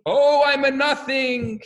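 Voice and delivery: high, loud, stretched-out cry; mock screaming. Second student answering the first, mock screaming. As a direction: wail it slowly across the hall. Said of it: "the other one's screaming".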